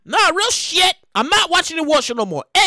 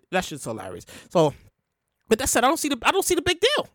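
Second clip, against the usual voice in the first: ordinary talk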